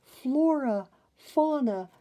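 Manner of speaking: high, loud calling of names; joyful calling. A narrator's reading. Princess greeting the fairies, loud joyful call. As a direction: call out to dear old friends. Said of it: "cried a voice they loved".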